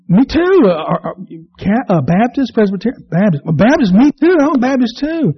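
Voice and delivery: thrilled to find a kindred soul; passerby gushing at a fellow Baptist; eager delight. Acted. Passerby delighted at shared faith.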